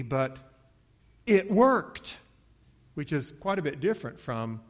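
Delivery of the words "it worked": high, loud burst of amazement; excited, raised and emphatic; thrilled realisation. Acted. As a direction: exclaim it as a delighted discovery. Acting the healed woman's excited thought.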